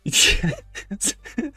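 airy high-pitched giggle; a helpless wheezing laugh; breathy high laugh. Real, not acted. Viewer laughing at an emotional scene, high airy giggle.

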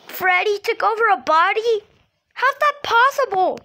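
high, loud, alarmed questioning; shocked disbelief. Acted. Learning Freddy took over a body, shocked and loud. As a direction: blurt it out in disbelief.

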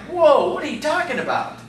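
high, incredulous imitation of listeners; mock bewilderment. Baffled crowd reacting to Jesus' words, mock-incredulous. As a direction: act out being thrown, pitch jumping up.

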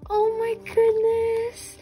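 high cheer, gasp, drawn-out squeal; cheer, then gasp in delight; overjoyed squeal. Real, not acted. Collector opening a long-delayed card, overjoyed squeal.

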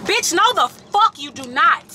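furious, cutting them off; angry rant, pitch climbing high; real anger. Real, not acted. Ranting at people who compared grief.

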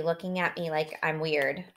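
ordinary delivery, nothing marked

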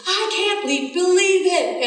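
high, drawn-out wail; retold distraught cry. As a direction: imitate a woman freaking out. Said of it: "she's screaming, crying".

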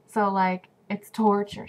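ordinary talk

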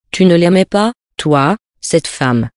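plain reading voice

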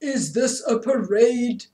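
deep voice. A narrator's reading. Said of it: "said a deep voice behind them".

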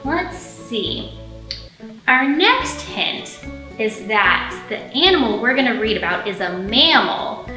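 usual speaking voice

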